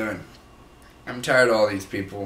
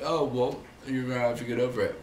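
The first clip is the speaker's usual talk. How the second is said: dismissive people. Retold or imitated. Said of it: "They're like".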